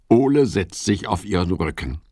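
ordinary delivery

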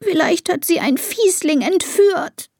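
high-pitched tearful whine; sobbing. A narrator's reading. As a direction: whimper your fear to dad. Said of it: "schluchzt Elisa".

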